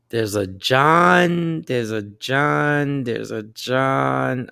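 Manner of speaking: slow, trailing search through names; slow drawn-out musing. Real, not acted. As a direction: stretch each word while searching. Scrolling contacts, drawing out words.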